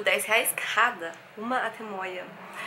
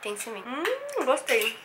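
speaking with food in mouth; chewing while talking; 2 semitones higher, slower than usual. Real, not acted. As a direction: mouth partly full. Seen 0: Spoon bite at -2.0, then closed-lip chewing through the labelled words.

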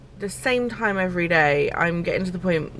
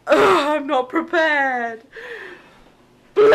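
mock dread; 9 semitones higher, 6 dB louder, slower than usual. Real, not acted. Dreading birth bleeding.

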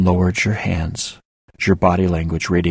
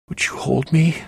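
put-on childlike plea; 8 semitones higher than usual. A narrator's reading. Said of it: "I asked like a child".

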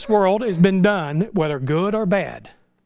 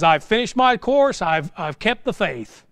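solemn slow quote; slower than usual. Retold or imitated. Paul quoted solemnly, slowing.

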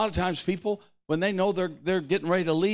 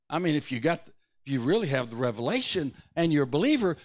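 normal talking voice

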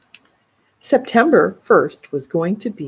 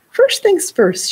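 strict teacher voice; 11 semitones higher, 5 dB louder, slower than usual. A narrator's reading. Violin teacher starts the lesson.

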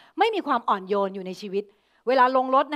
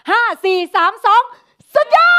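big shout; 9 semitones higher, 12 dB louder than usual. Shown as a demonstration. Teacher leading the class's second take, big excited shout. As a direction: count down then yell it, much higher and louder. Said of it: "คราวนี้ขอเบอร์ใหญ่".

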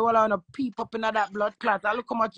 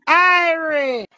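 loud, high, drawn-out Irie; excited greeting; 6 semitones higher, 8 dB louder than usual. Real, not acted. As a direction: call it back with joy. Echoing friend's Irie greeting.

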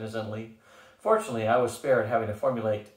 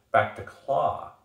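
grumbling; 3 semitones higher, 4 dB louder, much slower than usual. A narrator's reading. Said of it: "Gus grumbled".

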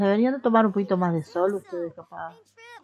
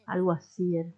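ordinary talk

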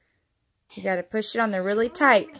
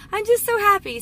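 so happy; 11 semitones higher, 6 dB louder than usual. Real, not acted. Mom sharing good speech news.

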